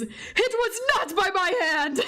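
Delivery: badly faked British accent; attempted British accent. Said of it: "I can't do accents".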